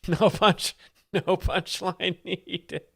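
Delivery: cracking up mid-sentence; laughing. Real, not acted. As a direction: squeeze the words out while laughing. Cracking up over the joke.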